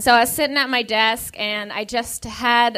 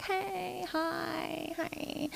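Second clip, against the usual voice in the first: breathy timid hello; timid whisper; 7 semitones higher, 16 dB quieter, much slower than usual. Retold or imitated. Manager sidling up to the desk, timid whisper. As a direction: mimic the manager creeping up softly. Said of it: "She was like".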